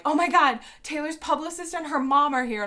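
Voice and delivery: high and loud, breathless fan news; excited gushing. Retold or imitated. Girls telling waiting fans the news, high and excited. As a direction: blurt it out like starstruck teens. Girls rushing past after meet.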